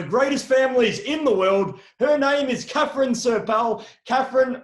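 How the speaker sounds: big announcer-style build-up; grand, loud introduction; guest introduction. Acted. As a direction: raise pitch and volume to present the guest.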